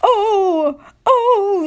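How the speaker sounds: high squealing cry; screaming trucks. A narrator's reading. Trucks jerked forward, high squealing scream. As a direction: shriek it high like dragged trucks. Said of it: "they screamed".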